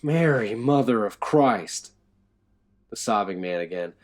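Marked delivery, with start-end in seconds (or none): 0.0-1.9 slow, louder shocked exclamation. A narrator's reading. Guard reaching the floor below, shocked. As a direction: gasp it out, stunned. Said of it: "taken aback".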